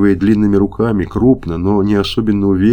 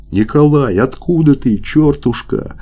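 higher-pitched, happy call; joyful cry; 6 semitones higher, slower than usual. A narrator's reading. Soldier hails the friend he thought lost, joyful cry. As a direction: cry out, overjoyed to see a friend. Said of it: "снова крикнул Лопахин весёлым, дрожащим от радости голосом".

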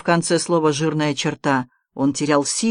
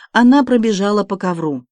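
ordinary delivery, nothing marked

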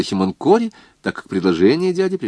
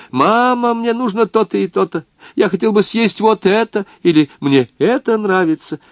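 lightly voiced son; 11 semitones higher than usual. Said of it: "Хоть бы раз сказал он мне".

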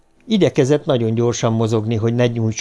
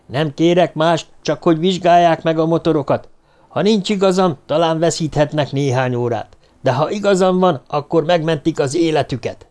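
urgent raised warning; 6 semitones higher than usual. Acted. Cornered man warns guards.

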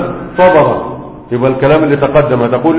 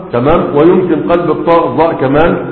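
ordinary talk, nothing marked

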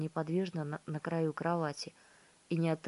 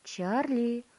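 calling out; 6 semitones higher, 4 dB louder than usual. A narrator's reading. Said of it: "позвала миссис Бакет из-за двери".

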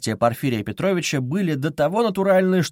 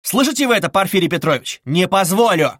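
higher, louder furious challenge; furious shouting; 5 semitones higher, 5 dB louder than usual. Acted. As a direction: shout it at him, pounding the table. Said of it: "крикнул он вдруг".

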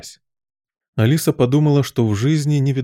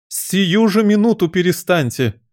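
high, scolding cry; imperious yell; 8 semitones higher than usual. A narrator's reading. Queen to bowing gardeners, yelling. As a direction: order them to stop at once. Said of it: "завопила королева".

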